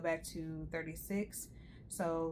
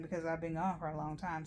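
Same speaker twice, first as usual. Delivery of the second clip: usual speaking voice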